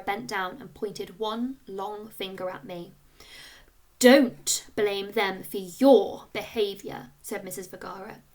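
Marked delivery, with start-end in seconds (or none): 4.0-7.1 loud, slow, stern teacher; teacher firmly scolding the boy; firm rebuke. A narrator's reading. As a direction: lay down the law slowly.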